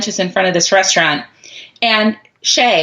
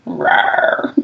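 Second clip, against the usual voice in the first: low breathy mock growl; playful monster growl; 14 semitones lower than usual. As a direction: growl like a pretend monster to scare.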